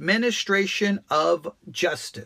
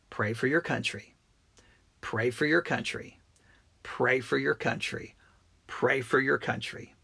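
softer, lower, repeated like a prayer; a softer, lower call to pray for the country; quiet earnest plea; 6 semitones lower, 7 dB quieter than usual. Real, not acted. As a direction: urge them gently to pray, softly.

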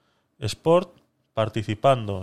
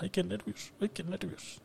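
higher, almost whispered nerves; nervous whisper; 7 semitones higher, 16 dB quieter than usual. Real, not acted. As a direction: breathe "ay, qué nervios" in a tiny high voice. (susurro agudo y nervioso)

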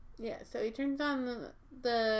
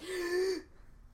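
starstruck gasp; 8 semitones higher than usual. Retold or imitated. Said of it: "and I was like".

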